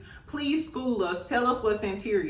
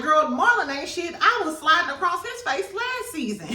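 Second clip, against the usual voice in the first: dismissive Gael; 7 semitones higher than usual. Retold or imitated. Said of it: "Gael like".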